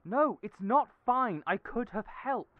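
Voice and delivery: loud, slow, high protest; upset insistence. Acted. Friend upset Julian hid his layoff, loud hurt protest. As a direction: refuse to let it go, hurt and firm.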